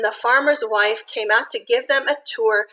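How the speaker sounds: usual speaking voice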